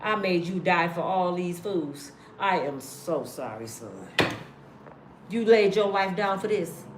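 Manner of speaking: low, hushed fatherly God, sorry for his son; weary God apologizing. Retold or imitated. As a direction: sigh it out like a tired father who made his son suffer. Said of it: "God probably up there ... be like".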